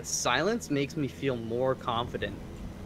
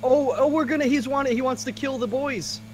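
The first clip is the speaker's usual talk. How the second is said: excited; 12 semitones higher, 7 dB louder than usual. Real, not acted. Teammate suddenly attacking them.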